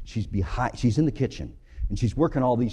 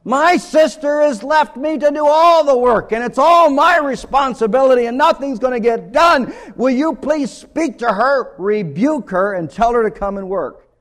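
high, loud exasperated wail at Jesus; a frazzled woman protesting shrilly, much higher and louder; angry complaint; 12 semitones higher, 10 dB louder than usual. Acted. Martha confronts Jesus about her sister, high and loud. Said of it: "looks straight in his face and says".

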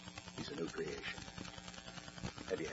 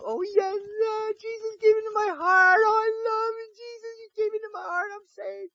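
much higher, louder mock-tearful wail; fake sobbing testimony; 14 dB louder than usual. Little Dickie making up a testimony for Mom, hammed-up sobbing wail. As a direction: ham up the crying to convince Mom.

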